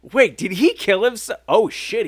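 high, loud mock alarm; acted shock, voice leaping up; shocked viewer. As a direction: react as a stunned audience member, pitch jumping. Acts out shocked documentary audience.

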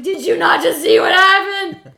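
loud, high-pitched mock wail; hammed-up sobbing. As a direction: cry it out in exaggerated disbelief. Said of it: "I started sobbing in the bathroom".

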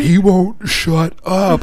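imitating an annoyed older brother; whiny kid. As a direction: drag it out like a fed-up kid. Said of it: "He's like".

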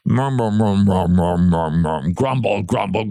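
low mumbled murmur murmur; mock grumbling. Preacher mimicking the grumbling Israelites, low mock mutter. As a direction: grumble it under your breath like a sulky crowd.

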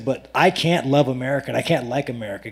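ordinary talk, nothing marked

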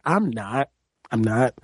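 low, unenthusiastic admission; real lack of enthusiasm; unexcited. Real, not acted. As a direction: drop the pitch, no excitement at all. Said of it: "you don't sound excited".

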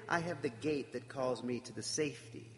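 ordinary talk, nothing marked

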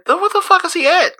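very high, incredulous outburst; retold exasperation. Retelling to cohost his panic over Cecil, very high incredulous yelp. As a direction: shoot it up high in disbelief. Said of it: "I said".

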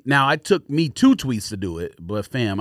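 usual speaking voice

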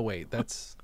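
ordinary talk

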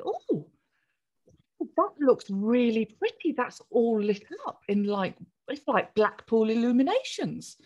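high, bright-eyed cheerful admiring; breezy, jolly delight, unaware of the news; jolly chirpy tone. Retold or imitated. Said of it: "I actually said it in that tone of voice".

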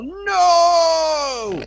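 high, loud anguished cry of no; yell. Retold or imitated. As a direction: wail it out at the closed car wash. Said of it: "And I yell".

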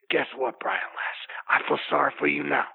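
low, intense wrestler promo voice; wrestling promo. Acted. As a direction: cut a heel promo, low and menacing. Wrestling promo character rant.

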